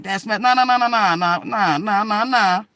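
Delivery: loud, high rapid nah nah; mimicking her brother waving it off; frantic protest. Brother breaking up the fight, loud frantic nahs. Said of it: "Dwayne was like".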